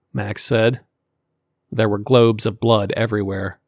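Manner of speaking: ordinary delivery, nothing marked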